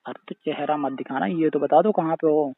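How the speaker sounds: normal talking voice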